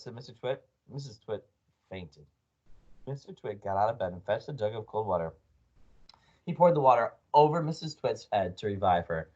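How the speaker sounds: plain reading voice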